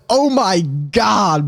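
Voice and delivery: slow, stunned outcry; shocked reaction. Retold or imitated. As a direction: stretch it out in re-enacted shock. His shocked reaction to the charge.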